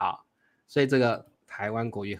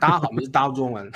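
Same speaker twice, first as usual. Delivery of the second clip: slurred fast Taiwanese; faster than usual. Shown as a demonstration. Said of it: "台湾国语很黏哦".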